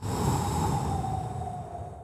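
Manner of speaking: long, soft breathy sigh; weary sigh. Real, not acted. Tired host, a long soft sigh. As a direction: sigh out like you're running out of steam. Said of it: "I'm struggling to keep this up".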